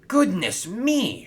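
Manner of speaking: high, slow alarmed cry; a shocked engine crying out high and slow; shout. A narrator's reading. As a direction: cry out in dismay. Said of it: "shouted Hero".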